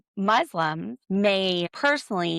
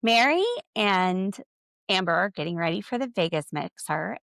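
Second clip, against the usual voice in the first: ordinary talk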